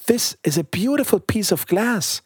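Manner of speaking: high-pitched delighted child, lightly acted; little girl's cry. A narrator's reading. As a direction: lift the voice high in childlike wonder. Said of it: "cried the little girl".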